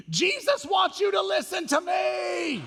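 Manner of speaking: put-on high, drawn-out child's scream. Four-year-old on the kitchen floor, screaming at dad. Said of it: "then he starts screaming".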